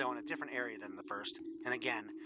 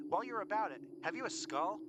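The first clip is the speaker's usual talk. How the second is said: higher-pitched lady calling over; lady's voice; 9 semitones higher than usual. A narrator's reading. Lady asking the traders for a skull, in a higher voice. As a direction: lift the voice into a lady's. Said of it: "came a lady's voice then".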